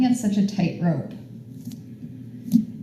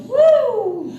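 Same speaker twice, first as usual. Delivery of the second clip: excited whoop; 14 semitones higher than usual. Real, not acted. Cheering after sighing out.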